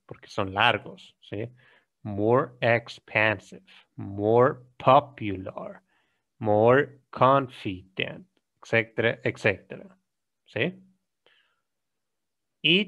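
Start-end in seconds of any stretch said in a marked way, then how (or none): 2.0-8.3 more stressed, each phrase slowed down; slow model phrases. Shown as a demonstration. Teacher modelling comparatives slowly. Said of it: "tengo que aumentar en todos el more".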